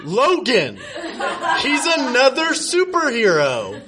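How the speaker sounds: hammed-up high coo. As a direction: coo it like a teasing lover. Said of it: "cooed Kyle".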